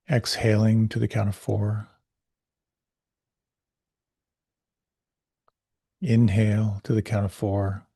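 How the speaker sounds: calm, unhurried meditation voice; guided meditation. Acted. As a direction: guide the listener gently inward.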